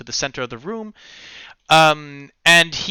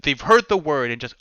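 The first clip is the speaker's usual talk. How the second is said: usual speaking voice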